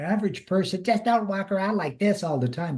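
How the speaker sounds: mock-nervous squeak; squeaky nervous voice. Shown as a demonstration. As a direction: put on a high, squeaky voice. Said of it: "you're squeaky because you're very nervous".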